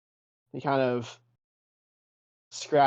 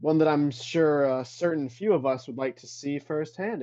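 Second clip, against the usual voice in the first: taunting Jedi spirit; 3 semitones higher than usual. Acted. Spectral master taunts Adrian to prove his gift, mocking challenge. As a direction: dare him to show his power.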